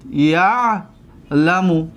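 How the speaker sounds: یعلم drawn out very slowly, split after the first syllable; slow syllable demonstration. Shown as a demonstration. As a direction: stretch the first syllable of یعلم, pause, then finish it. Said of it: "دقت بتید".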